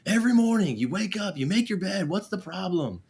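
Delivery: high-pitched, worked-up nagging rant; exasperated parent. Retold or imitated. Parent nagging the kids about unmade beds, exasperated.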